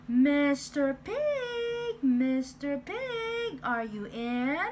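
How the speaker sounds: slow, high, loud drawn-out call. Acted. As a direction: call slowly up to the door. Wolf calling at door.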